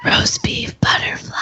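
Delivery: high, slow stage whisper. Pretend. Repeats the chant whispered.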